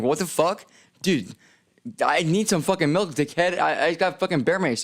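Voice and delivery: high-pitched indignant complaint, retold; mock fury at the shelter guy; exasperated outburst. To the shelter guy refusing milk, indignant high outburst.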